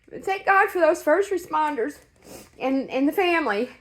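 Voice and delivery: overwhelmed, pleading to be understood; emotional. Real, not acted. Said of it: "I'm so sorry I'm getting so emotional".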